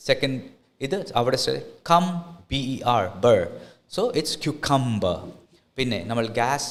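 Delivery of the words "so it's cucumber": cucumber drawn out slowly as kyoo-kum-ber; slow model word. Shown as a demonstration. Teacher slowly modelling cucumber for the class. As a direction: spell out cucumber slowly, kyoo sound first. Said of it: "becomes Q".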